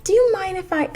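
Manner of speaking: very high put-on asking voice; mock wheedling. Line-cutter asks shopper to let her in, mock wheedling. Retells woman asking to cut in.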